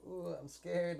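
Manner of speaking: high hammy mock fright; over-the-top acting. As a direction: ham up a fake scared gasp. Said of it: "over the top".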